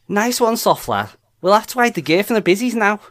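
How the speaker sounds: sing-song Scouse, rising high at the end; Scouse accent. Shown as a demonstration. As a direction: rattle it off as a Scouser. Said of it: "Go on then, [name]".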